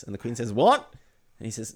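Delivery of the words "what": high, loud startled question; sharp what. Pretend. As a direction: snap it out startled. Queen snaps sharply.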